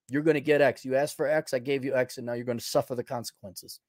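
ordinary delivery

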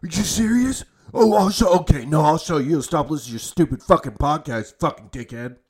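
high, loud indignant fan voice, fast at the end; offended college football fan ranting at the podcast host; rabid fan rant. Retold or imitated. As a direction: play the outraged Ducks fan snapping back. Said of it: "they're like".

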